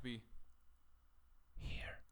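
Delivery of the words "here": soft whisper. Real, not acted. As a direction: whisper near the mic. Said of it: "I have to whisper".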